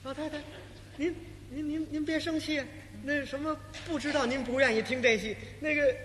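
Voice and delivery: nervous stage manager placating the warlord's mother; fearful manager stammering. Acted. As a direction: beg her not to be angry, barely daring to speak. Manager pleads with the old lady.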